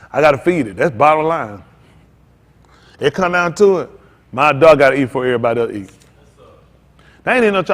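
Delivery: ordinary talk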